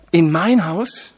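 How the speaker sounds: loud, slow, incredulous question; cry. A narrator's reading. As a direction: keep crying out in disbelief. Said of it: "schrie er". (lauter, ungläubiger Ruf)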